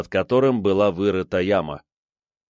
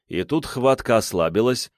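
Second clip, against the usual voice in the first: plain reading voice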